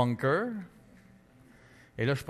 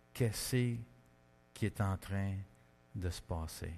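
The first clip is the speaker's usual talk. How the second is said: slow, fading to a lower, softer hush; awed hush; 6 semitones lower, 9 dB quieter, slower than usual. Retold or imitated. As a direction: trail off in quiet wonder. Awe at God's sign.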